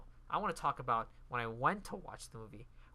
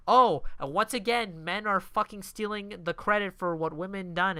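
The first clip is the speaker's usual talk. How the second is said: raised, louder imitation of indignation; hammed-up indignant complaint; mock pissed off; 5 semitones higher, 6 dB louder than usual. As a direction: mimic her annoyed outburst. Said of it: "she gets all pissed".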